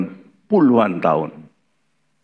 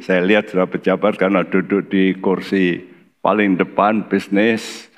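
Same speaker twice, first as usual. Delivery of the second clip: ordinary delivery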